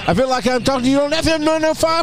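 shock jock DJ voice. Pretend. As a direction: hype it up like a morning radio host. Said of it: "I totally went to shock jock D J voice".

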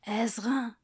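breathy whispered name. Shown as a demonstration. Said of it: "voice test whispered".

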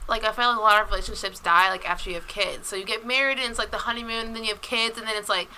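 real off-mic softness, understated; too quiet off the mic. Real, not acted. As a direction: talk softly, drifting away from the microphone. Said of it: "you wanna use the mic, or you just don't talk".

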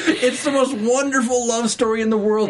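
high, laugh-tinged gushing. Real, not acted. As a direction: gush with delighted fan excitement. Gushing over favourite TV couple.

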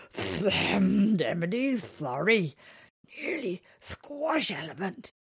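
slow, apologetic creature voice; big gentle creature. Acted. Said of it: "he said".

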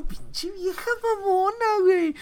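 high, slow, whiny exasperation; exasperated whine. Retold or imitated. Student griping about her teacher, slow high whine. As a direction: drag it out in disgust. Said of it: "que yo digo".

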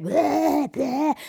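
hammed-up double retch; imitated retching. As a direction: gag it out twice, like throwing up. Imitates date retching in restroom.

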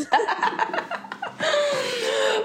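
high, breathy burst of laughter; long laugh. Real, not acted. Friend laughing hard at their shared memory, high laugh. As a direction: crack up at the memory. Friends recalling a funny trip.

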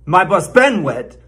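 BEEN punched in "my bus been wet"; wrong stress on BEEN. Shown as a demonstration. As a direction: lean hard on been, the third word. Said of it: "put the stress on the wrong syllable".

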